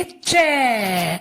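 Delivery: चै held long, gliding down; drawn-out syllable. Shown as a demonstration. Teacher showing how च takes the matra, drawn-out चै. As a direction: stretch the vowel of चै. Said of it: "इसे हम बोलेंगे".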